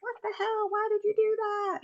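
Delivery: very high mock-exasperated parent; imitated angry outburst. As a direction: act out overreacting in a squeaky-high voice, without shouting. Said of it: "this reaction of, you know, anger".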